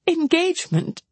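high, shocked exclamation; acted disbelief in a high voice; startled cry. A narrator's reading. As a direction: throw the word back, pitched high. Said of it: "cried Marianne".

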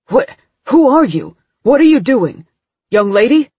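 alarmed demand. A narrator's reading. Agent confronting the sobbing girl, loud demanding. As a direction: corner her with questions.

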